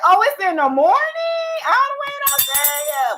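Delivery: high amazed exclaim; surprised. Real, not acted. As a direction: sound delighted by the news. Surprised at time zone.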